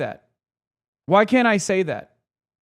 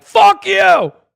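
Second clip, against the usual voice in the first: a high, loud yelled retort, slower at the end; sudden high shout of a nihilistic douchebag; mock cynic shout; 9 semitones higher, 8 dB louder, slower than usual. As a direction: bark it back like a furious cynic. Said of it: "nihilistic douchebag being like".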